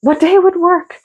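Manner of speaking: repeating her husband's question back, incredulous and high; incredulous echo. Retold or imitated. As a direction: echo his words in disbelief.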